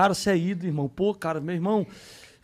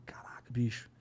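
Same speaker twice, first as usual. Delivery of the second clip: whisper; 7 semitones lower, 8 dB quieter than usual. Real, not acted. Amazed at the delivery.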